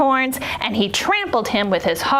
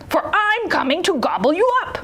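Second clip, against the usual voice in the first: higher, lightly voiced storybook troll; menacing troll; 7 semitones higher than usual. A narrator's reading. Troll threatens the second goat, mildly menacing. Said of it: "said the troll".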